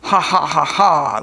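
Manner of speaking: low, deep-ish evil toilet laugh; mock villain laugh. A narrator's reading. Toilet villain laughing at the boys. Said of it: "laughed the powerful porcelain predator".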